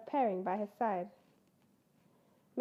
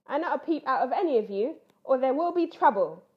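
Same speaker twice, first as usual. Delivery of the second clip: fast, much louder booming warning; louder, fast stern boom; 2 semitones higher, 8 dB louder than usual. Acted. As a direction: boom a threat at the cubs. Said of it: "boomed Mister Bear".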